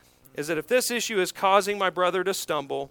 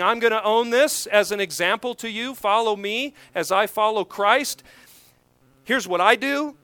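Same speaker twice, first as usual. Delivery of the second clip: louder "Follow me", with punched stresses; emphatic declaration; 3 semitones higher, 5 dB louder than usual. Retold or imitated. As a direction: hit "own this" and "Follow me" hard, with raised voice. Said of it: "Paul is being emphatic".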